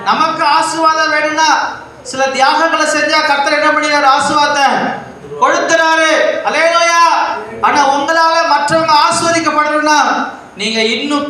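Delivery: high, sustained exhortation; fervent preaching. Real, not acted. Preacher urges congregation to sacrifice for blessing, high and fervent. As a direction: press the point home from the pulpit.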